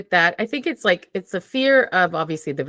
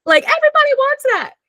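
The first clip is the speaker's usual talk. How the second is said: high, mock-bright gushing voice; much higher sing-song mock enthusiasm; mocking cheerful people; 12 semitones higher than usual. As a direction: parody people who cheer you on. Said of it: "people are like".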